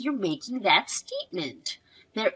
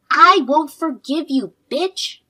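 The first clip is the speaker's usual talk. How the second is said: put-on child screaming in rage, much louder; furious outburst; 3 semitones higher, 7 dB louder than usual. Girl shouting at her mother's enemy in rage. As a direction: spit the insult out with all her fury. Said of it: "I can't believe a girl would say that".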